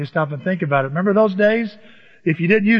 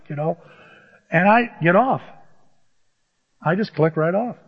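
ordinary talk, nothing marked

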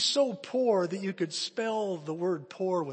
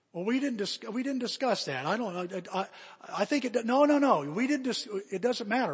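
higher, stammering hesitation; flustered teacher; 6 semitones higher, 5 dB louder than usual. Acted. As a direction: stumble over the answer, acting flustered. Teacher flustered by John's question.

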